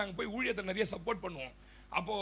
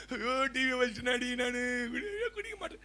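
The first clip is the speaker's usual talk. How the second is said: imitating drunk crying; 3 semitones higher than usual. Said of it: "பூச்சி அழுவான்".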